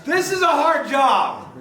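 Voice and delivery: high, loud and slow, drawn out in disbelief; overwhelmed comic lament; mock exasperation. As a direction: moan it like the task is absurd. Exasperated about impossible job.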